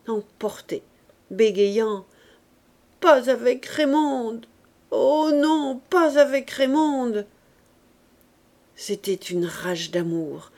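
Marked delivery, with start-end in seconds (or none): 3.0-7.3 slow, louder, higher sobbing outburst; sobbing plea. A narrator's reading. Widow sobbing to the mother not to choose the niece. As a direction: break down and beg through sobs. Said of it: "elle éclata en gros sanglots, vaincue, emportée, bégayant".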